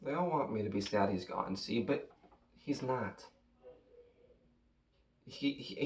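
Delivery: very soft and low, faltering; subdued grief. Acted. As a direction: barely voice it, holding back the loss. Son refuses to accept father's death.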